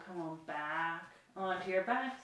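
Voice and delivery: ordinary talk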